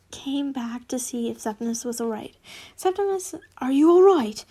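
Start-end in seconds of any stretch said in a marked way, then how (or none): 2.8-4.5 loud, worried call of his name; concerned call. Acted. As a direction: call out to him, alarmed. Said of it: "asked in concern".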